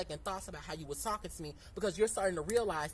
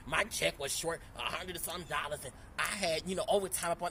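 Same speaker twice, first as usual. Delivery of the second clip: imitating him; 2 semitones lower than usual. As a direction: mimic his grumbling without pushing it. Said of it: "let me stop talking like that".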